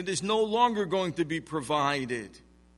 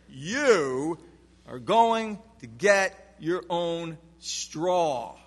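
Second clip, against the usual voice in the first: "You" punched louder, the rest slow and deliberate; slow command with a loud "You" up front; emphatic you; 4 dB louder, much slower than usual. Shown as a demonstration. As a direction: lean hard on "You", then spell out the order slowly. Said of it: "the you is emphatic".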